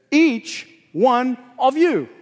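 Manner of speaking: slow, weighty "Each one of you", leaning on "Each"; stress on each. Shown as a demonstration. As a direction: drag out "Each one of you" with heavy emphasis on "Each". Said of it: "the pronoun each here is in the emphatic position".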